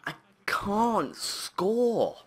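slow whispered lament; slow hopeless whisper; despairing whisper. Real, not acted. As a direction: whisper it in defeat. Despair after missing chances.

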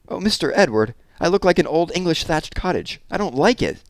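high-pitched complaint of consternation; dismayed protest. A narrator's reading. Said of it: "cried Mona in consternation".

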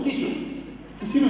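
usual speaking voice

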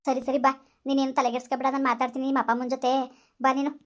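calm her down and lead her home; consoling friend. Acted. (ಸಮಾಧಾನ ಮಾಡುವ ಗೆಳತಿ)

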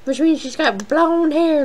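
a drawn-out, slow, hammed-up southern accent on Goldilocks; mock southern accent, stretching the name out; bad southern accent. Gamer echoing the game's line, in a bad southern accent. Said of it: "really bad, like, southern accent".

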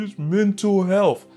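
ordinary talk, nothing marked

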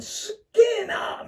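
high, slow, loud cry of wonder; amazed exclamation. Retold or imitated. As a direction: marvel out loud at it, stretched. Amazed at his own old posts.

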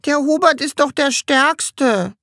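put-on a helpless little girl; girl answering Sams with a shrug; shrugging girl. A narrator's reading. Girl continues.

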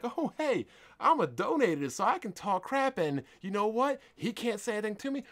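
high, cocky mock voice, speeding up at the end; mocking a smug donator. Mocking entitled donators who think they can talk trash. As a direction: sneer it like an entitled donor showing off. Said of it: "acting like".

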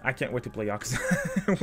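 ordinary delivery

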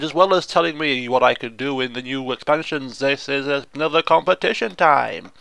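ordinary talk

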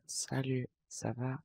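quiet faked girl voice; soft, put-on girly greeting; man doing a woman's voice. Shown as a demonstration. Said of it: "est-ce que ma voix de meuf, elle était cramée ou pas".

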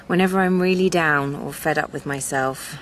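ordinary talk, nothing marked

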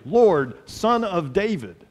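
ordinary delivery

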